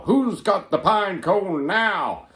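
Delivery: high, loud, slow gleeful yell; yelling taunt. Retold or imitated. Pranksters yelling at the woken tent, slow gleeful yell. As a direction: holler it at the burning tent. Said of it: "and you yell".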